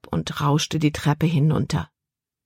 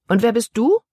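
haughty question; 6 semitones higher, 4 dB louder than usual. A narrator's reading. Haughty girl questions boy.

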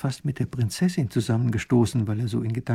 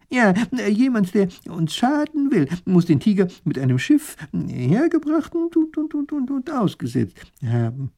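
much higher, flustered, stuck on und; stammering professor; 9 semitones higher, 4 dB louder than usual. Acted. Professor explaining the enemy, flustered stammer. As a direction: stumble over the words, higher and higher.

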